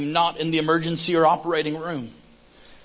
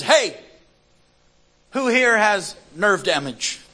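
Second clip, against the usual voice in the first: higher-pitched call to a crowd, drawn out; retold public shout, moderately raised; 4 semitones higher, slower than usual. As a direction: call out slowly to everyone aboard. Retold calling out to passengers.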